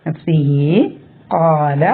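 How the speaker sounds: slow നഫ്സിഹി with the hi stretched two counts; two-count lengthening. Shown as a demonstration. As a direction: hold the final i of നഫ്സിഹി two beats. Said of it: "രണ്ട് കൊണ്ട് നീട്ടുക".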